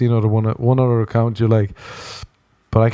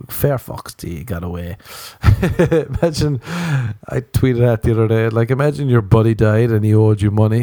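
ordinary talk, nothing marked

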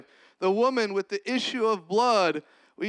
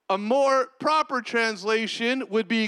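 ordinary delivery

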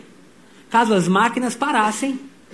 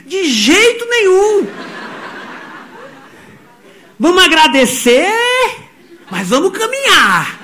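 son's voice; 9 semitones higher, 4 dB louder, slower than usual. Retold or imitated. Son's reply voiced by father.

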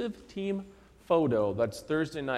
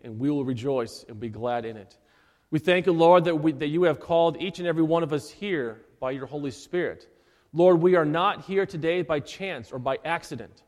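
earnest pastor's prayer, measured and fervent; 4 semitones lower than usual. Acted. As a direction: pray it over the congregation, full of thanks. Leads opening prayer.

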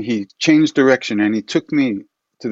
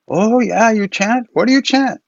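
put-on high, curious old lama; intrigued lama asking about chanting; Rinpoche's voice; 12 semitones higher, 4 dB louder than usual. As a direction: imitate the Rinpoche, much higher and louder.

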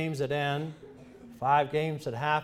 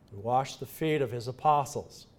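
ordinary delivery

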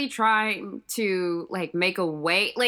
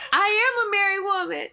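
mock wail; 11 semitones higher than usual. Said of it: "And I was like".

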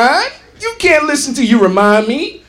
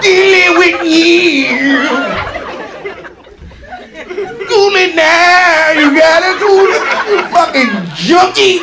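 mock [name]; 4 semitones higher, slower than usual. Comic mocking the singer as a slurring junkie. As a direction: croon it like a strung-out singer.

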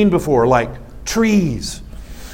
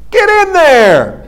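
high, loud, drawn-out order; sponsor's yell; 10 semitones higher, 5 dB louder, much slower than usual. Retold or imitated. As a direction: holler it across a field, imitating the sponsor. Misheard sponsor shout, acted.

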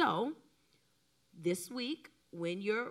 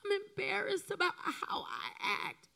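tearful confession; 10 semitones higher than usual. Retold or imitated. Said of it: "And I just cried".

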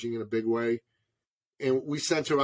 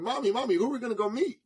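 high-pitched imitation of an eager child; put-on small child's voice, high; little boy; 10 semitones higher, much faster than usual. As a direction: pipe up like a kid in the back seat. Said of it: "my little boy, sitting in the back of the car is going".

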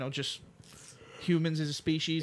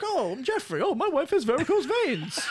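high silly voice; 10 semitones higher than usual. Retold or imitated. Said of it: "He's like".

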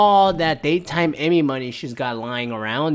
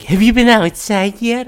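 higher, louder mock chirpy question; mimicking eager friends; 8 semitones higher, 7 dB louder than usual. Friends pestering him about going out, mock chirpy voice. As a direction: parody the nagging friends.

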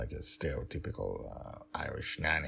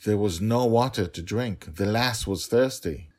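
louder, higher, firm insistence; insistent nanny; 6 semitones higher, 8 dB louder than usual. Acted. Nanny insisting to the father the child was thirsty, firm. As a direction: stand your ground on the lass.

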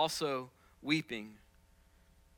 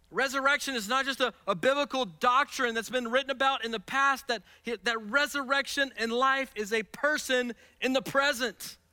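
raised, high, ringing preacher's voice; preaching at full force; 10 semitones higher, 7 dB louder than usual. Acted. Pastor urging the church family, preaching full force. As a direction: proclaim it to the whole church.

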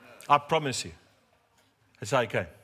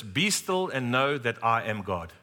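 slow, solemn reading of the verse; slow solemn quote; slower than usual. Retold or imitated. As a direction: let each word land slowly, like scripture. Pastor quotes God's word.

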